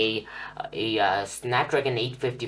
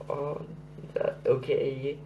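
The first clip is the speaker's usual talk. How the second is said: usual speaking voice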